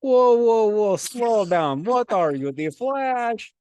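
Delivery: loud, high, drawn-out mock shout; hammed-up coach yelling to slow down; exclaimed halt. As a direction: holler it like an exasperated coach stopping someone. Imitates coach halting a rushed demonstration.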